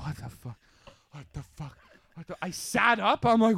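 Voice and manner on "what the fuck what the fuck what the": shaken. Retold or imitated. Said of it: "I was like".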